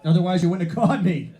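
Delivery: high, loud retort; acted indignation. Driver answering the traffic cop, indignant and loud. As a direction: fire back at the officer loudly.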